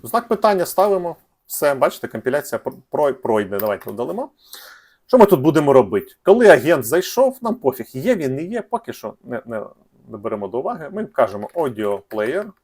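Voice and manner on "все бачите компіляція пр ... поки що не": ordinary talk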